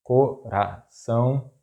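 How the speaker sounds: coração slowly split, co - ra - ção; syllable by syllable. Shown as a demonstration. Teacher breaking down an example word, coração slow in syllables.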